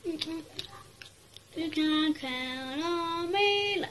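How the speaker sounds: slow heroic boast. Pretend. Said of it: "I'm a flash".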